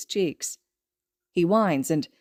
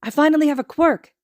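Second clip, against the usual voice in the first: high and louder, thrilled; excited child; 9 semitones higher, 7 dB louder than usual. Acted. Izuku gushing to Kacchan about his quirk, high and excited. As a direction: gush to your friend. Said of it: "he says".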